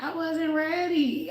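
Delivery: high, slow, whiny I wasn't ready; whiny protest. Retold or imitated. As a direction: drag it out like a reluctant kid. Retelling her protest to God.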